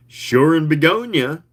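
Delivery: plain reading voice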